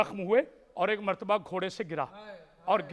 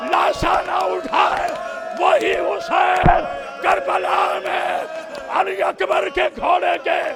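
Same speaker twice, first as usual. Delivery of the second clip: high, loud sustained wailing oratory; full-force majlis preaching; 7 semitones higher, 7 dB louder than usual. Acted. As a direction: cry the tragedy out to the mourners.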